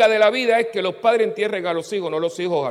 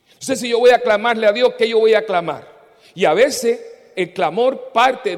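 predicando fuerte; 4 semitones higher, 7 dB louder than usual. Real, not acted. Predicador alza la voz.

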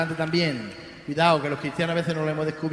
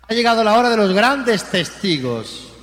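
louder, higher, ringing from the pulpit; full-force preaching; 4 semitones higher, 4 dB louder than usual. Acted. Priest to his congregation, rousing full-force preaching. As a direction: proclaim it to the whole church.

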